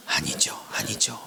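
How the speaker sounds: low, whispery pleading; hushed mock denial; reluctant prayer. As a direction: murmur it as a hopeful private prayer. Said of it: "아닌 쪽으로 막 기도를 몰아가".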